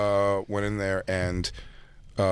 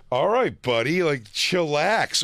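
mock calming tough guy; 7 semitones higher, much slower than usual. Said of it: "he was like".

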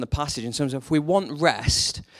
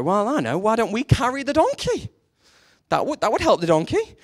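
pitched-up, eager suggestion; mock bright idea; 8 semitones higher, 3 dB louder than usual. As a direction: act out a naive brainwave. Father and son get bright idea.

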